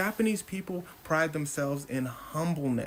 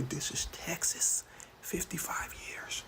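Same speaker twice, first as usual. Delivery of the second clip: hushed mock judge whispering the verdict; 7 dB quieter than usual. Judge whispering the sentence to the courtroom.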